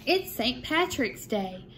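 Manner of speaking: lively sing-song picture book reading; bouncy storytime voice with a lifted refrain; reading to children. A narrator's reading. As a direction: read the rhymes aloud to a second grade class. Reads picture book to class.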